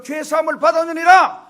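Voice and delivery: high, ringing declaration; loud proclamation. Acted. Jesus declaring forgiveness to the paralytic, loudly proclaiming. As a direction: pronounce it loudly with authority.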